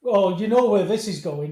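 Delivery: usual speaking voice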